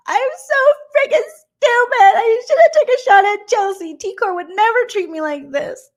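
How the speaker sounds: very high, loud hammed-up crying; mock sobbing wail. Recapper imitating blindsided housemate hiding in her room, mock wailing. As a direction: squeal it like an exaggerated tearful meltdown.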